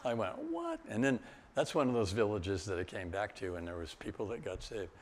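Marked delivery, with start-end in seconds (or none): 0.4-0.8 astonished what. Retold or imitated. Retold astonished reply.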